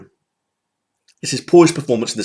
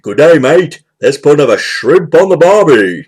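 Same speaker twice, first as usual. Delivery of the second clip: imitating an Australian accent, a bit louder; 3 semitones higher, 5 dB louder than usual. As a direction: ham up a mock Australian accent like a movie character. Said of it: "Then he puts on that accent".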